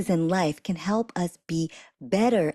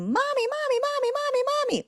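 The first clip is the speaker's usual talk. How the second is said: put-on very high, insistent child calling mommy; toddler calling mommy; faster than usual. Mother imitating her toddler calling for mommy. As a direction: whine for mom like a toddler who won't stop. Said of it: "your toddler is screaming your name".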